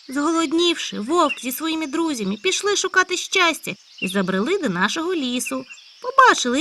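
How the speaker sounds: high loud eager hare; a hare bursting with news. Acted. Hare character voice. (високий гучний схвильований заєць)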